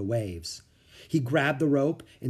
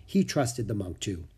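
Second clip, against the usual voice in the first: ordinary delivery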